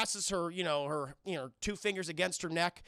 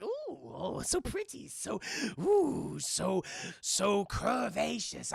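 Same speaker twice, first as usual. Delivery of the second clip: lecherous admiration; much slower than usual. Retold or imitated. Acts out leprechaun admiring bride.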